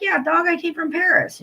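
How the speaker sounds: high, mocking sarcasm; sarcastic retort. To the clinic staff after the wasted trip, high sarcastic jab. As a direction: toss it back up high, dripping sarcasm. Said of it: "I'm like".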